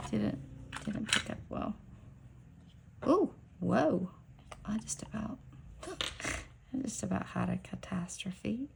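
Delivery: breathless whisper after a near spill, with a laugh; whispered relief that ends in a small laugh. Real, not acted. After almost spilling the polish, she whispers with a laugh.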